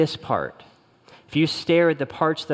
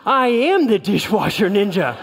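higher, louder, proud declaration; proud ninja boast; 7 semitones higher, 6 dB louder than usual. Retold or imitated. Husband answers wife's praise, proudly boasting, loud and high. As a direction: puff up like a hero taking a bow. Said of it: "And I was like".

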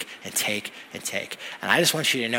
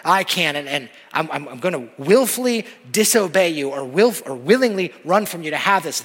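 loud, heated insistence, pitched up; willful demand; 5 semitones higher, 6 dB louder than usual. Acted. A stubborn believer defying God, willful demand. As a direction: lean hard on the defiant words. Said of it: "a hardness of heart that demands something".

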